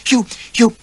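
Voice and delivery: high loud stammer; spluttering outrage. A narrator's reading. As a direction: splutter in fury.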